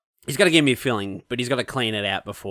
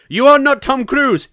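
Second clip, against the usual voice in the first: much higher, louder, slow mock snap; snapping dentist; 13 semitones higher, 10 dB louder than usual. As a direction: snap it at the patient like a scolding dentist. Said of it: "he just snaps at me".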